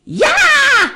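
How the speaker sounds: very high loud yelp; startled yell. A narrator's reading. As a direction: yelp out in shock. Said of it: "a scream".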